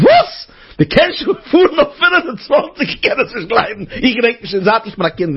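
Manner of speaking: high-pitched mock outrage; incredulous outburst. Speaker reacting to the new twenty-five speed limit, incredulous. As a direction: squawk it in high disbelief.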